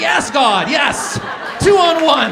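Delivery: loud, high victory yell; cheering yes, loud and high; triumphant cheer. Retold or imitated. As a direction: celebrate like scoring a goal.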